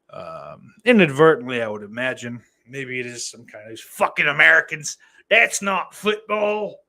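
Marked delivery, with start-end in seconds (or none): 3.7-6.8 put-on loud, high, botched Irish accent; failed Irish accent. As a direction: scold in a badly faked Irish accent. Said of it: "I don't know what the fuck that accent is".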